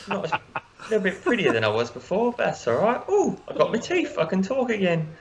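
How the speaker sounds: put-on cheeky working-class English accent; chirpy halfling in an English accent. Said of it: "for inspiration for that accent".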